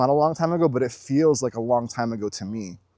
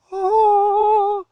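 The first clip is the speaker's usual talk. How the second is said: long, high held cry of rapture; high sung-out "ah"; 5 dB louder than usual. Acted. Showing viewers pure presence, a high rapturous "ah". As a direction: let out a sustained high "ah". Acts out being lost in the moment.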